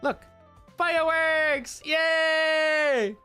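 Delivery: normal talking voice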